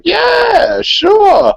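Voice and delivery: mock Texan lady, high and stretched; drawled Texan lady. Texas shop lady answers beer question, mocking drawl. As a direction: imitate the Sherman lady's big friendly yes. Lady's exaggerated Texas reply.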